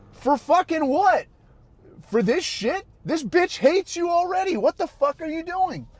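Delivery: high, incredulous retold rant; retold exasperation. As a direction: plead with a friend in disbelief. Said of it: "I'm like".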